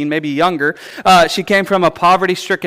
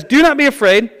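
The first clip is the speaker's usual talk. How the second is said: higher, louder angelic command; booming angel; 8 semitones higher, 4 dB louder than usual. Retold or imitated. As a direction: call out to calm a frightened girl. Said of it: "He says".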